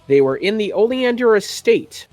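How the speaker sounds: ordinary talk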